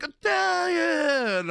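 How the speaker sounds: very high, slow shouted "Italian"; hammed-up operatic Italian yell; mock Italian shout. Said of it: "They're like".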